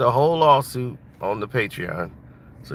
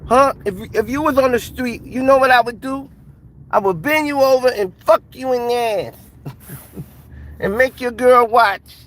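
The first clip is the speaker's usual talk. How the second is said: much higher, louder menacing boxer voice; Mike Tyson; 13 semitones higher, 6 dB louder than usual. Retold or imitated. Tyson threatening the man who robbed him, high and loud. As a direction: threaten him like the champ, pitched way up. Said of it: "They're gonna be".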